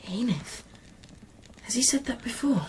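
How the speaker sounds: whisper. Acted. Whispering near sleeping monk.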